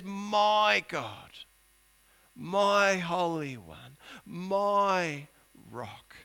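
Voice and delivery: slow, drawn-out devotion fading to a hush; reverent prayer. Acted. Preacher voicing Habakkuk's reverent prayer, slow and fading. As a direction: linger on each name of God. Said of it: "he says".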